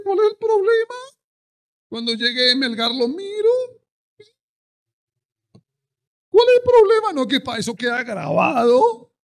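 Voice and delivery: very high, loud mocking voice of a lazy believer; mock the members who skip service; careless churchgoer. Pastor mocking lazy members who watch later, high mocking. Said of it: "lazy churchgoer skipping live service".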